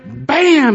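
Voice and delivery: high, loud "bam"; loud imitated slam. Telling congregation how he slammed the microwave, loud imitated bang. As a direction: punch out the bang of a door.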